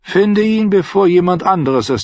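high, loud urgent command; urgent order. Acted. Tyrion urging Bronn to find the singer, urgent high command. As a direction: press the command home, pitched well up.

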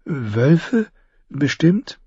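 high, put-on frightened questions; feigned fear. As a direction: pretend to be scared of wolves. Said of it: "Sie gab sich Mühe, ängstlich zu wirken".

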